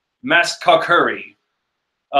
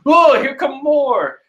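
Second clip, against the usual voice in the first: mock yell; 9 semitones higher, 4 dB louder, slower than usual. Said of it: "we hear Brook yell".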